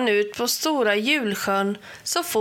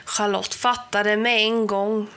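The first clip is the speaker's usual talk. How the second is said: usual speaking voice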